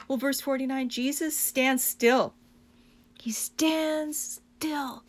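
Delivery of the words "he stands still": slow awed whisper. Real, not acted. Preacher whispering as Jesus halts the crowd. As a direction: drop to a breathless hush.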